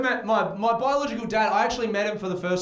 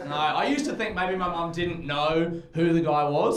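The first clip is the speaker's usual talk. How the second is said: usual speaking voice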